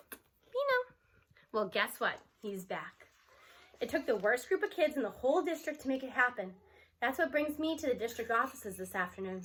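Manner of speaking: ordinary delivery, nothing marked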